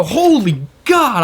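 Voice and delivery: high, drawn-out cry of panic; dramatized freak-out, slow and high; retold freak-out. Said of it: "I freak out".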